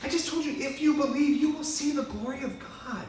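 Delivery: Jesus gently correcting Mary; Jesus reminding. Retold or imitated. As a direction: remind her patiently from on high. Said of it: "he just reminds her".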